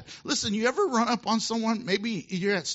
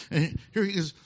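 ordinary talk, nothing marked

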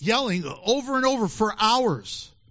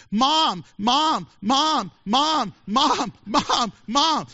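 a hint of a whiny child, higher; nagging kid; 4 semitones higher, 4 dB louder, much slower than usual. Kid in Walmart nagging his mother, lightly mimicked whine. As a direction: pester mom over and over, lightly voiced.

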